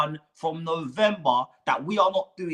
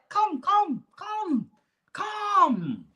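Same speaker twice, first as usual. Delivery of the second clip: mock whining plea; 12 semitones higher, much slower than usual. Pleading high whine.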